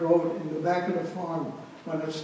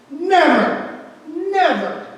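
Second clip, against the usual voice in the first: loud high Never! twice, hammered home; emphatic shout; 11 semitones higher, 11 dB louder, much slower than usual. Real, not acted. Survivor tells the audience, shouting Never! in full earnest. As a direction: bang the table on each Never!. Insisting they never went outside.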